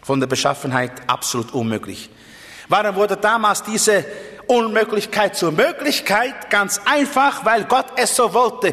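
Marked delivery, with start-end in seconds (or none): 2.7-8.7 full-force preaching. Acted. Preacher to his congregation, proclaiming God's will, at full force. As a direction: drive the answer home to the whole hall.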